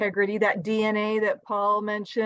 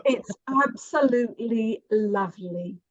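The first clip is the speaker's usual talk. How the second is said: British accent. Shown as a demonstration. Host approving the actress's accent as a Brit, British accent. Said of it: "Coming from a Brit".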